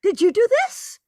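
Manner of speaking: high, loud burst of astonishment; amazed exclamation. Retold or imitated. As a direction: gasp it out, thrilled and shocked. Woman amazed on seeing quilt.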